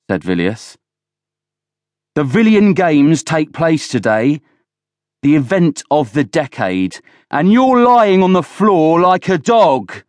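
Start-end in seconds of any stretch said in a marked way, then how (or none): 2.1-10.0 high, forceful rant building louder; angry tirade. A narrator's reading. Villiers berates Oland on games day, angry tirade.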